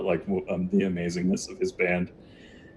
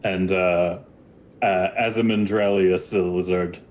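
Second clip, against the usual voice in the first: normal talking voice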